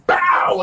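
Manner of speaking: high, sudden mimicked bang; imitated impact. Storyteller mimicking his head hitting the wall, explosive.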